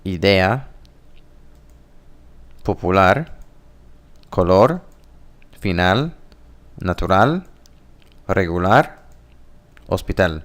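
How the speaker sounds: Spanish word list from "Idea" to "hospital", end-stressed; Spanish pronunciation. Shown as a demonstration. Teacher reading look-alike words to learners, Spanish pronunciation of "Idea" through "hospital". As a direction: read "popular", "natural", "regular" as Spanish, last syllable stressed. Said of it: "there's some differences in pronunciation".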